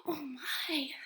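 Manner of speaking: breathless, whispered oh my; gasped whisper. Acted. Frightened possum seeing the robot, whispered gasp. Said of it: "the possum gasped".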